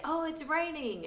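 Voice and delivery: high-pitched pretend surprise; playful exclamation. Parent sprinkling water on a child at bath time, playful exclamation.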